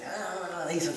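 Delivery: normal talking voice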